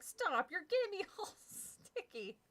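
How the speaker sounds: put-on girl laughing as her dog licks her face. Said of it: "I cried, laughing".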